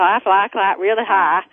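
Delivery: playful Southern echo, "fly" as "flah"; Southern accent. Retold or imitated. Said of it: "their southern accent".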